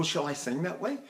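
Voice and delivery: ordinary talk, nothing marked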